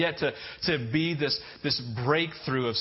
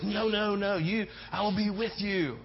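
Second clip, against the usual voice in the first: higher eager vow; Peter's promise; 5 semitones higher than usual. Retold or imitated. Peter vowing loyalty to Jesus, eager pledge. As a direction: Peter insisting he'll stay.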